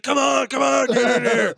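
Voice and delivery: high, loud urgent yelling at a friend; hollering to hurry someone in; excited shout. Retold or imitated. Louis J. Gomez yelling at a buddy to get in, loud shout. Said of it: "and he's like".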